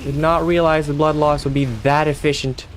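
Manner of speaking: high panicked exclamations; startled near-death yelp; alarmed outcry. Real, not acted. Nearly killed in game.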